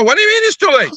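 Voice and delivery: high, disbelieving squawk; retold outrage at the phone call; incredulous. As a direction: shriek the question in disbelief.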